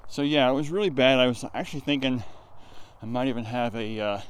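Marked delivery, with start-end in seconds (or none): none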